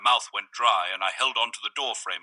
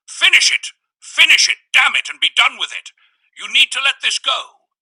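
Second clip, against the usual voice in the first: much higher, loud furious shouting; the man yelling; 14 semitones higher, 10 dB louder than usual. A narrator's reading. Visitor forcing the door, shouting at the narrator. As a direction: yell the orders at full force.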